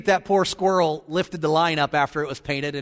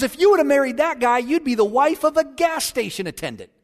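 indignant; 7 semitones higher than usual. Retold or imitated. President scolds wife about her old boyfriend, mock indignation. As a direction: lecture her as an affronted husband.

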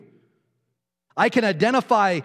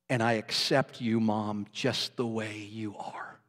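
quiet, lower, sinking to a whisper at the end; tender farewell; 5 semitones lower, 3 dB quieter than usual. Real, not acted. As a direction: speak softly to her at the deathbed. Son speaking to dying mother.

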